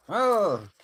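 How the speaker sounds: blockhead voice. Acted. Friend suggesting a stage voice, mock blockhead. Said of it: "put on the blockhead voice".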